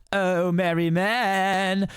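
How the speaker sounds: very slow singsong summons, stretching the last word; calling out. A narrator's reading. Outlaw calling his men from the woods, drawn-out call. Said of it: "calls into the woods".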